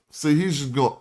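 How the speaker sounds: normal talking voice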